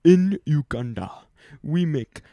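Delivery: put-on a second, slightly bolder Ugandan accent; mild imitation of the Ugandan speaker, "Uganda" rising a little. Retelling the visitor's school talk, mild Ugandan accent. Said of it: "I shouldn't do that accent".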